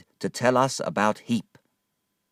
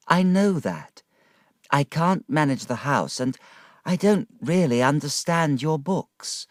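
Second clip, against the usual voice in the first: a touch of wifely pleading, lifted in pitch; Dora, earnest pleading wife; 5 semitones higher than usual. Acted. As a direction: plead lovingly with a husband.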